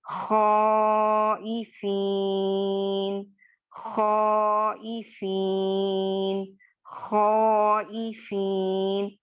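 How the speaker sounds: खौइफीन drawn very slowly with a full, thick खौ; heavy reading. Shown as a demonstration. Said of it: "कैसे?".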